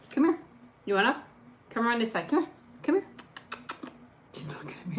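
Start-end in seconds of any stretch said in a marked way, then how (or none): 4.3-5.0 fast whispered aside; whispering. Real, not acted. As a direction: whisper it to viewers. Aside while coaxing the dog.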